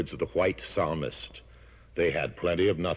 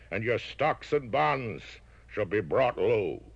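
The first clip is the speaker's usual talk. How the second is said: LBJ curse; 8 semitones higher than usual. Acted. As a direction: pronounce the doom deliberately. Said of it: "And unto Adam and Eve he said".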